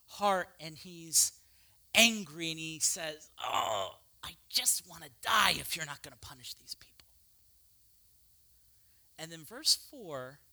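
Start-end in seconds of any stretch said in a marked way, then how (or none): 3.4-7.1 whispered, lower and softer; despairing whisper. Acted. Jonah whispering his death wish to God. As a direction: breathe out the wish to die.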